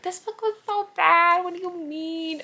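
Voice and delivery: high mock scoffing reader; high mocking imitation; imitating a critic. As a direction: put on a shrill disbelieving voice. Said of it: "They're gonna be like".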